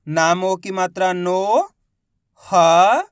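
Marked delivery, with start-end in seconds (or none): 1.1-1.7 नो stretched long with a rising end; syllable by syllable. Shown as a demonstration. Teacher showing the vowel sign, नो drawn out. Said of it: "एक ही अक्षर का उच्चारण करके". 2.4-3.0 ह as a long, loud, rising syllable. Shown as a demonstration. Teacher sounding out letters for learners, ह drawn out. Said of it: "एक ही अक्षर का उच्चारण करके".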